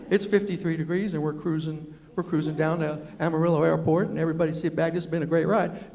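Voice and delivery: low, easygoing West Virginia pilot voice; Chuck Yeager. Acted. Pilot talks to passengers like Chuck, low and folksy. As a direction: talk like a calm pilot on the cabin address. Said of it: "wanna talk like Chuck".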